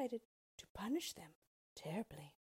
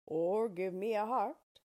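Tin Woodman voice; 3 semitones higher, 8 dB louder than usual. A narrator's reading.

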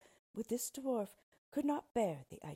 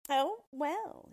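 higher, louder grudging oh well; grudging dwarf; 9 semitones higher, 8 dB louder than usual. Acted. Dwarf pretending reluctance, grudging. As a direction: condescend to accept. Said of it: "he replied".

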